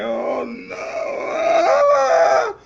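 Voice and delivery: high, drawn-out cry of dismay. Retold or imitated. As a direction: wail it out in comic despair. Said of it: "I'm like".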